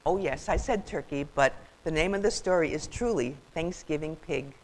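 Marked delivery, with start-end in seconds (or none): none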